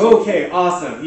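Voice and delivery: loud, high burst of delight; excited reaction. Acted. Speaker voicing her response to one moved viewer, excited.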